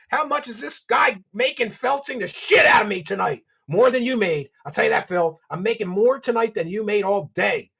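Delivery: loud, high gloating taunt in Phil's voice; mocking gloater. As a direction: gloat like a smug streamer counting cash.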